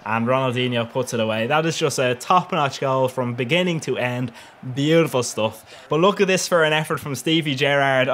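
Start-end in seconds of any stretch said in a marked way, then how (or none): none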